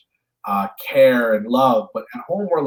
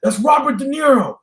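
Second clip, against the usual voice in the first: pushy street guy; 14 semitones higher, 5 dB louder than usual. Retold or imitated. Tourist-shop guy pushing a photo.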